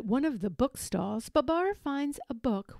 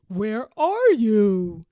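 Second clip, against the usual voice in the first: long drawn-out, loud call; stretched searching holler; 8 dB louder, much slower than usual. A narrator's reading. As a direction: call to someone out of sight, slowly. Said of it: "calls Babar".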